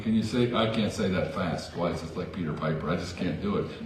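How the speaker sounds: ordinary talk, nothing marked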